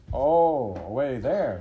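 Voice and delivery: loud relieved Oh; dismissing the danger with relief; relieved farmer. Acted. Farmer to dog, loudly relieved.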